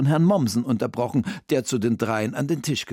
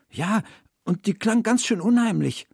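put-on high, eager young storybook heroine; Sophia als Figur; 11 semitones higher than usual. A narrator's reading. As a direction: report something eerie in a high girl's voice. (hohe, aufgeregte Mädchenstimme)

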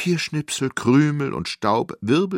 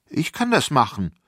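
put-on eager, high child's offer; volunteer brightly, pitched up; excited kid's call; 6 semitones higher, 4 dB louder than usual. A narrator's reading. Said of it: "rief ich".

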